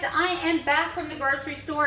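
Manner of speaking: usual speaking voice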